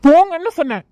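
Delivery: high, loud outburst; exasperated yell, pitch jumping up; angry shout. Acted. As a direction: snap and order them out. Tense man orders everyone away.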